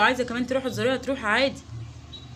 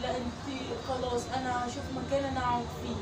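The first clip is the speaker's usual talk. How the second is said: very soft, gentle plea; tender pleading; 3 semitones lower, 8 dB quieter than usual. Acted. Mother begging her daughter not to divorce, soft. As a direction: plead quietly, almost hushed.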